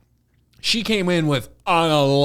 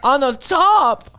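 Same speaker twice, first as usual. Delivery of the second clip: slow, much higher mock moaning; 10 semitones higher, 3 dB louder, slower than usual. Friend imitates the actress in the fight, slow high mock moan. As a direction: stretch it out like a swoon. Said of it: "she went".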